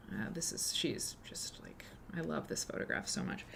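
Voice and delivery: adoring whispered aside; whispering. Real, not acted. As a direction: whisper it fondly. Aside about a loved photo.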